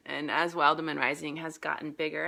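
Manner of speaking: normal talking voice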